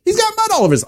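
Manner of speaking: high, mocking astonished gossip; gossiping onlookers. Passers-by gawking at the blind man with mud, high mocking gossip. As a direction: gawk and sneer at the muddy man. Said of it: "he hears the people as they're talking".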